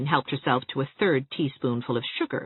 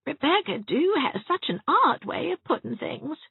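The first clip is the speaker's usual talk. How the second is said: higher, mildly aggrieved complaint; complaining; 14 semitones higher than usual. A narrator's reading. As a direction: grumble about Rebecca's manners. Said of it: "complained Cousin Ernestine".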